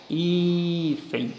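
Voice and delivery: teacher pointing out the stressed syllable of Hífen; stress shown. Shown as a demonstration. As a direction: lift and lean on HÍ-fen. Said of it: "aqui está a tonicidade".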